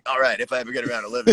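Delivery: fast radio DJ announcement. Pretend.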